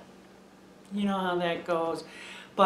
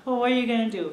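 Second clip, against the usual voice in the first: ordinary delivery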